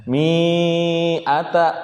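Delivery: MI ATA chanted with MI held long; lengthened MI. Shown as a demonstration. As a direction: stretch the I of MI before ATA. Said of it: "Apa dipanjangin?".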